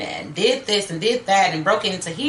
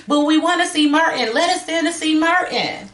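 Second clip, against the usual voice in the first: high, louder mock child demand; taunting little girl; 8 semitones higher, 4 dB louder than usual. Playing Mary calling through Judy's closed door, taunting. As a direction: imitate a girl clamoring to be let in.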